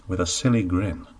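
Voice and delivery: ordinary delivery, nothing marked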